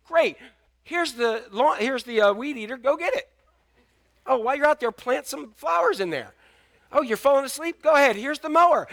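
high, bright mock parent piling on chores; chirpy parent voice, only lightly put on; mock cheerful chore-giver. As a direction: play the cheery parent handing out yard work. Acted parent handing chores.

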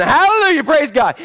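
mock Pentecostal shout, high and loud; shouted hallelujah. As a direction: call it out like an excited churchgoer. Pentecostal congregant calling out.

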